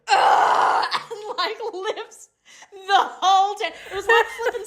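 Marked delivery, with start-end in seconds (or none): 0.1-0.9 lion roar. Retold or imitated. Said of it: "lion roars".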